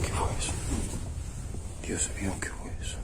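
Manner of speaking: scared hushed whisper; frightened whisper. Real, not acted. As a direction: whisper it, terrified. Hears noises in dark.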